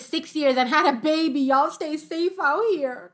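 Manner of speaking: high, loud, slow dramatic reading. Real, not acted. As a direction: drag out the warning. Reading shocked comment.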